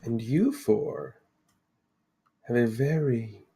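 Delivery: slow, measured mystic seer; mysterious Lady of the Lake. Acted. Lady introduces herself to four adventurers, solemnly mysterious. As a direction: unfold it slowly, with weighty pauses. Game master voices the Lady.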